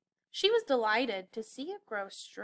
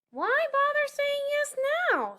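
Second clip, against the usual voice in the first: much higher cartoon penguin voice; sassy penguin, pitched way up; 5 dB louder than usual. A narrator's reading. Penguin sassing the elf, squeaky high.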